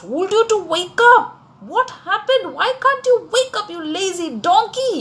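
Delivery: high, quick, exasperated telling-off; angry scolding. A narrator's reading. Owner scolds the donkey for oversleeping, angry. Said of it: "he was very angry".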